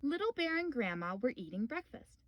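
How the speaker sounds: ordinary delivery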